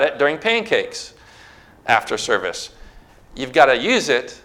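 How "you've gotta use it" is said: ordinary talk, nothing marked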